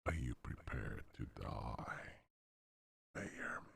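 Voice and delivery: low menacing whisper; creepy whisper. Acted. Creepy villain tests voice changer.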